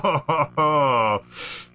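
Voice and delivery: startled laugh; laughing at own blunder; burst of laughter. Real, not acted.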